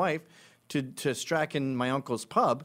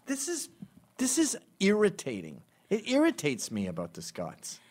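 ordinary talk

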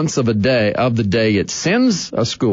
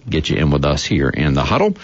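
ordinary talk

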